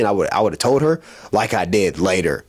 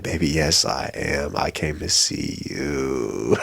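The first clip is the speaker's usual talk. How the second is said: put-on much softer, slow, lover-boy reassurance; smooth whisper-soft talk; 7 semitones lower, 6 dB quieter, slower than usual. Telling his date he came just for her, soft and smooth. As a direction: croon it low and smooth. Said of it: "And I'm like".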